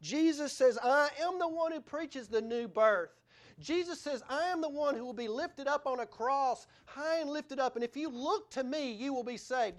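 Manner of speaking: high, ringing sermon delivery; forceful preaching. Acted. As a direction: proclaim Jesus' words to the congregation. Preaches Jesus' words forcefully.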